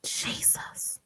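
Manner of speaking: whispered, with long silences; soft, overcome whisper; stunned, near tears. Real, not acted. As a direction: breathe it out in disbelief.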